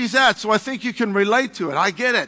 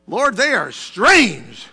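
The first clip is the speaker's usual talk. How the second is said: ordinary delivery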